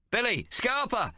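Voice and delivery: high, drawn-out shout; yell. Acted. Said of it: "I yell".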